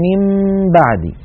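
من بعدي with nasal m hidden before b; correct iqlab. Shown as a demonstration. Teacher gives the correct reading. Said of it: "فلما نقول".

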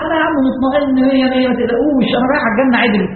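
high, carefree brushing-off; mock overconfidence. Mimics overconfident believer.

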